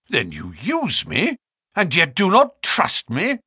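wounded reproach, rising at the end; a touch of hurt indignation; some bitterness. A narrator's reading. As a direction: protest bitterly, pitch climbing on the second half. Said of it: "I cried with some bitterness".

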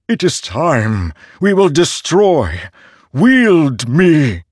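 high, slow, otherworldly hungry blade; a living sword craving to be used; eager sword voice. A narrator's reading. Nightblood urging Kaladin.

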